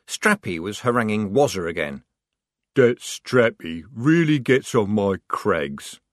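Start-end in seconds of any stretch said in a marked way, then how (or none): 2.7-6.0 slow troll grumble; slow, grumbling troll voice; muttering troll. A narrator's reading. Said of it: "muttered Carborundum".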